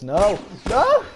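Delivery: loud, high shocked no; shouting no. Real, not acted. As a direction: yell it in disbelief. Reacting to a game moment.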